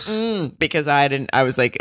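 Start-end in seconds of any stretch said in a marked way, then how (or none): 0.0-0.6 high, soft dismissive mm-mm; a light snap of disapproval; mock Lindy snap. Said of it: "Lindy just snapping and saying".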